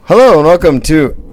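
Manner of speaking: loud, booming show opener; mock podcast intro. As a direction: boom out the intro as a joke. Fake podcast intro, then "just kidding.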